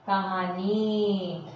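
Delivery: कहानी said slowly, ka-haa-nee; correct pronunciation. Shown as a demonstration. Teacher showing how कहानी is said. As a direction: stretch कहानी evenly. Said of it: "कहानी हम क्या बोलते हैं".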